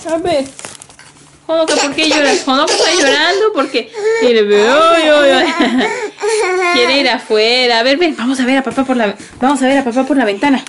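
más fuerte y aguda, sobre el llanto; calmando a la bebé. Real, not acted. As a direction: alza la voz para calmar a la niña. Consuela a la bebé que llora. (mamá habla fuerte a su bebé que llora)

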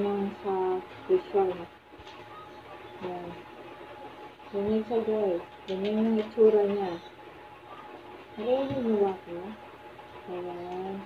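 muffled behind mask; speaking through filters; through a respirator mask. Real, not acted. Seen 2: Half-face respirator with pink filters worn over mouth throughout.

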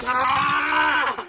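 high loud yell in icy water; cold shock yell. Real, not acted. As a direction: cry out from the freezing cold. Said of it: "a lot colder than I thought".

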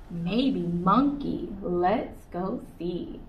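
slow, drawn-out and teasing; slow suspense. Acted. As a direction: stretch it out to build suspense.

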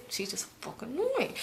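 a gossiping whisper, real; whispered aside. Real, not acted. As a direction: drop to a whisper to dish. Gossipy aside about Brittany.

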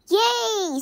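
high, loud yay; cheer. A narrator's reading. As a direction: celebrate the new team artist. Players cheer.

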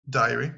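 diary said as die-uh-ree with an English r. Shown as a demonstration. Teacher repeats diary in English for the class. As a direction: give diary its English sounds. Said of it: "le journal intime".

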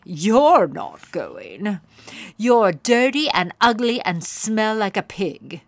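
crush her hopes; cruel taunt. A narrator's reading. Pony taunting Poniella she can't go, cruel. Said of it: "said Plumpkin".

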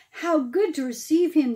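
plain reading voice

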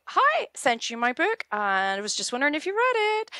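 high, sweet and chirpy; lilting high mock-polite pitch voice; bright polite email voice. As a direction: play the cheerful follow-up nudge. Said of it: "you would go".

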